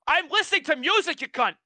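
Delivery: high-pitched indignant outburst; irate retelling. Retold or imitated. Worker retelling telling off someone who interrupted the music, irate. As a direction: raise the pitch and snap at an interrupter. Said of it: "I was so irate".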